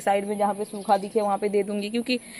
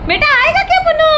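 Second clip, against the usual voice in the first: very high, louder calling; high coaxing call to the parrot; pet talk; 7 dB louder than usual. Real, not acted. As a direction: call the bird sweetly. Calling the bird.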